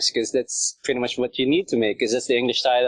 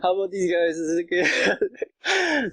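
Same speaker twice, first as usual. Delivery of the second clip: high-pitched, slowing imitation of asking approval; mock timid offer; 7 semitones higher, slower than usual. Moderator showing users the renovation, mock timid offer. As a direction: offer it up high, drawing out the question. Acts out presenting the renovated subreddit.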